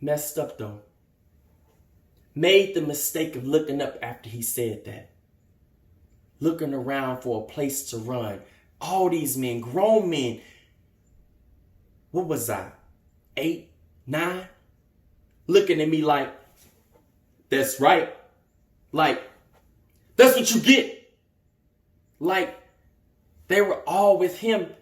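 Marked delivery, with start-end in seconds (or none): 0.0-16.3 low, subdued, with long pauses; hurt recollection. Acted. Young man recalling grown men watching him, hurt and subdued. 20.2-21.1 louder, higher scornful rebuke; scornful jeer. Acted. Grown men looking at the boy, scornful jeer.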